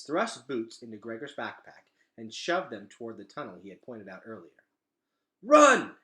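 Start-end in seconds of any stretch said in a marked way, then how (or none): 5.4-6.0 much louder, higher urgent command; ordering shout. A narrator's reading. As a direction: yell it as an order. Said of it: "he ordered".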